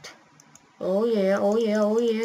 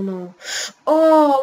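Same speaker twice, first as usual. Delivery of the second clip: normal talking voice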